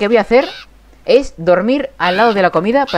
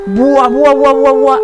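amazed exclamation; 3 semitones higher, 5 dB louder, much slower than usual. Real, not acted. Amazed after the fight.

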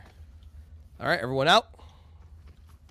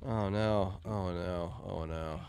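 fading dismay; 6 semitones lower, 9 dB quieter, much slower than usual. Real, not acted. Player eliminated, repeated lament.

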